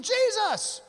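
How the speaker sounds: a high, loud, offended cry of Jesus; scandalised church crowd objecting to Jesus, high and loud; exasperated church people. Retold or imitated. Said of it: "they were probably all thinking".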